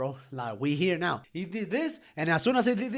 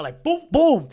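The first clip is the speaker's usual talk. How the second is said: loud high boom boom; shouted punch noises; punch sound effects; 8 semitones higher, 8 dB louder, slower than usual. Retold or imitated. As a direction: punch out two loud booms.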